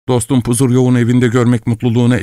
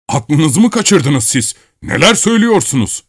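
put-on old man shouting; 7 semitones higher than usual. A narrator's reading. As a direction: shout at the intruder in outrage. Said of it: "diye bağırmaya başladı ihtiyar". (ihtiyarın öfkeli bağırışı)

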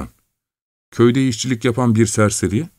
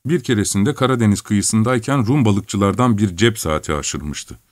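ordinary delivery

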